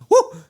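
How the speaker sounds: sudden loud high fan woo; excited woo. Real, not acted. Host cheering as Punk's music hits, loud woo. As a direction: whoop like the arena just popped.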